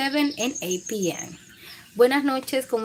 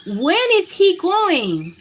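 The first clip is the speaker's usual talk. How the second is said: put-on fed-up child; 9 semitones higher, 8 dB louder, much slower than usual. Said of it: "I asked".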